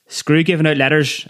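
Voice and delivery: calling out a warning to the lads, yelled. Acted. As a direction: yell it out through the door. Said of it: "I yelled in Gaelic at the top of my voice".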